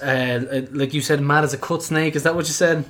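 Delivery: normal talking voice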